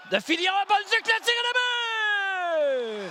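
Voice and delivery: rising shout into the goal; excited goal call. Real, not acted. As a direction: build up to the goal. Goal call by play-by-play announcer. (montée vers le but)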